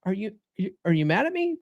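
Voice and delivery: a hammed-up anxious boyfriend pleading; mock insecure stammer. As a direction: stumble over it like a worried boyfriend. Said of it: "So you're like".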